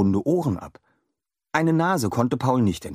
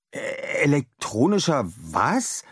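staunend; 6 semitones higher than usual. A narrator's reading. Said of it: "staunte Paul".

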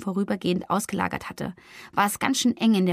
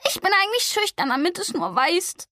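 higher, sharp and tearful snap; snapping at him; 9 semitones higher than usual. Acted. Flori snapping at Gregor in frustration. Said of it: "fuhr sie ihn an".

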